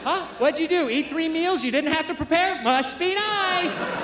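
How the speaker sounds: high, sing-song mock of his wife, slowing on must be nice. Comedian mimics his wife needling him in prison: high mocking sneer.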